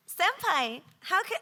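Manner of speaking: put-on high squeaky anime girl voice; cutesy anime girl.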